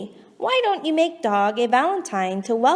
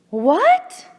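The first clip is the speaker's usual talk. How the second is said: higher, louder startled rising what; surprised what; 6 semitones higher, 5 dB louder than usual. Real, not acted. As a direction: react in sudden surprise. Shocked at the poem.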